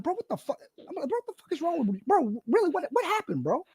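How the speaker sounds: high, strained mock confrontation; imitating a worried friend. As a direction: play the shocked friend, pitch up high.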